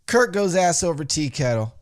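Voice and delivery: usual speaking voice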